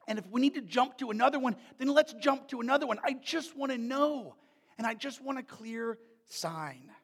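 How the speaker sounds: ordinary talk, nothing marked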